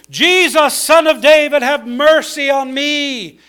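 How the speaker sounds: loud, high plea shouted over a crowd; beggar's emphatic cry for help. Acted. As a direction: call out for mercy, stretching the last words. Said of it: "He began to cry out and say".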